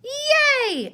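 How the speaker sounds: a very high, loud, long whoop; excited cheer. A narrator's reading. Tigger cheering his friends' valentine idea, excited. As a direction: bounce into a huge cheer. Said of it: "agreed Tigger".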